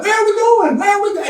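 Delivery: high-pitched calls receding up the line; calling out. Acted. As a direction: relay it on and on, very high. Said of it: "they call out".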